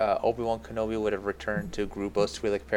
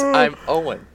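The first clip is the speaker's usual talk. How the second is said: high, loud deadpan Uncle Owen; hammed-up stiff line reading; mock Owen voice; 8 semitones higher, 9 dB louder, slower than usual. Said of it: "he says".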